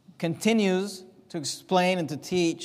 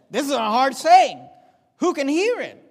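higher and louder, pressing the hard saying; emphatic repeat; 6 semitones higher, 7 dB louder than usual. Shown as a demonstration. Preacher repeating the disciples' complaint to the church, emphatic and loud. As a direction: hit the complaint hard the second time.

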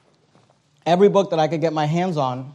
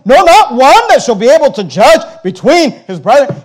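loud, driving pulpit delivery; preaching at full force; 6 semitones higher, 9 dB louder than usual. Acted. Pastor thundering scripture at his church, loud. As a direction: hammer the verses home.

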